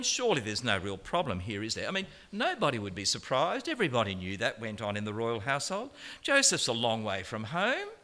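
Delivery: ordinary talk, nothing marked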